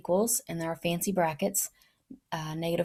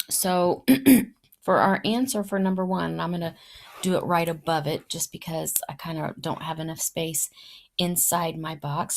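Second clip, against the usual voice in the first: ordinary delivery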